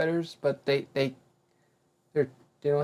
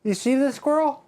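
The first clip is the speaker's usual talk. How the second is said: incredulous; 3 dB louder than usual. Real, not acted. Joking about spider size.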